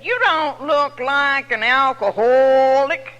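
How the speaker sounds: slow, drawn-out mimicking of the coffee girls; mock the girls, slow and laughing; mimicking counter girls. Speaker mimics the two counter girls, slow mock drawl. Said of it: "I'm only mimicking them".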